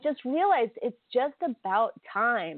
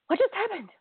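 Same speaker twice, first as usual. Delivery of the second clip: same question again, pitched much higher; rising disbelief; 11 semitones higher than usual. Retold or imitated. Watching the finale end, she asks herself in rising disbelief. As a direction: let the shock climb on the repeat. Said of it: "And I was like".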